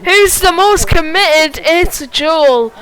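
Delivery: high, loud and drawn-out race call; excited call. Real, not acted. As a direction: call the winner of the battle, slow and loud. Climax of overtake.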